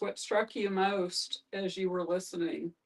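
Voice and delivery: ordinary delivery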